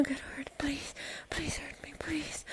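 hushed pleading whisper. Real, not acted. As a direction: drop to a whisper and beg.

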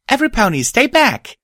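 high, loud commanding shout. Acted. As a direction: shout a warning to everyone. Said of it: "I shouted".

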